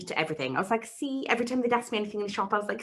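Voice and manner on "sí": timid "See." for sí, said small and high; a meek little "See." said far too quiet; shy Spanish yes said as "See."; nervous "see". Retold or imitated. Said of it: "I would say "see".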